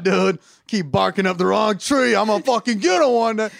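an angry little dog cursing squirrels; ranting dog voice. Retold or imitated. Imagined stressed dog ranting.

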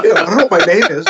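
ordinary talk, nothing marked

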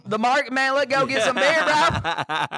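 hammed-up hillbilly accent, laughing. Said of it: "turn into fucking redneck hillbilly".

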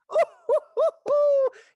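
high, loud mocking cackle; mean hyena cackle. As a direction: cackle at the croc like a jeering hyena. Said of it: "That was my hyena for you".

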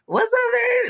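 put-on very high squeaky baby talk. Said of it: "doing little baby talk things".

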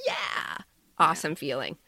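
normal talking voice

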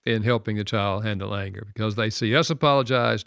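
normal talking voice

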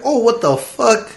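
high-pitched, drawn-out disbelief; startled. Retold or imitated. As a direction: replay the shock in a high, stretched voice. Pistol aimed at him, startled.

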